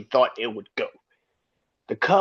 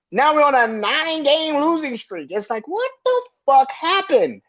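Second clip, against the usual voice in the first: exasperated rant; 9 semitones higher than usual. Real, not acted. Cubs fan to his viewers, outraged at nine straight losses, high exasperated yelling. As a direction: vent the frustration, pitched way up. Fan ranting about losing streak.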